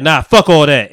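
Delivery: dismissive king. Retold or imitated. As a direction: shut it down hard. King rejecting brother.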